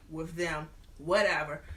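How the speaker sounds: ordinary talk, nothing marked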